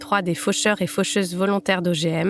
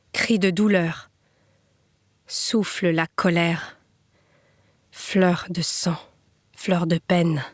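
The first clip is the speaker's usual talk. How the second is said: spare phrases set apart by long pauses; solemn poem recital. Acted. Reader in memory of a fallen young man, solemn recital.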